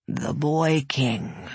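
low, slow rumbling dragon. Acted. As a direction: rumble it like distant thunder. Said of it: "Its voice was a low rumble".

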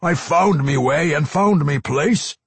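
high, loud, defiant dwarf; indignant dwarf vampire; Pwent. A narrator's reading. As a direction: insist loudly in a dwarf's brogue.

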